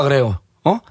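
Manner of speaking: usual speaking voice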